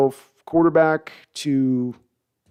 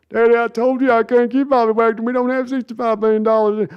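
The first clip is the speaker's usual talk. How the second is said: high mock son explaining; patient son, pleading with dad; imitating Jerry's son; 10 semitones higher, 4 dB louder than usual. Son tells Jerry the money is gone, high mock voice. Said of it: "Jerry and Sons impression".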